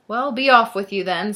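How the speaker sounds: loud, grudging troll dismissal; gruff storybook monster sending someone away; snarling troll. A narrator's reading. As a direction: snarl it out at the little goat. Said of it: "snarled the troll".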